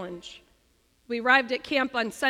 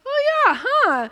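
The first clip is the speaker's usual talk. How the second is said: put-on much higher, louder childlike surprise; surprised kids; 12 semitones higher, 9 dB louder than usual. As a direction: chirp it like her surprised kids. Her kids answering, retold.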